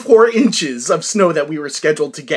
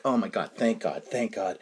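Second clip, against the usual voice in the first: low, soft retold thanks; hushed relief; 8 semitones lower, 8 dB quieter than usual. Shopper seeing mother pull daughter to the line, hushed relief. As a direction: say it under the breath, relieved. Retells own relief, under his breath.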